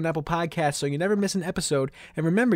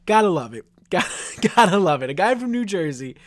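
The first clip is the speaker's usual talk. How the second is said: amused; 2 semitones higher, 6 dB louder than usual. Real, not acted. Mocking candidate's fake accent.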